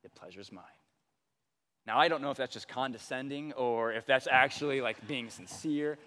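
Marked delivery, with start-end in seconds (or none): 0.0-0.6 soft, low imitation of a calm dad; much softer, lower hint of a serene dad. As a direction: gently voice your calm father. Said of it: "he just looks at us".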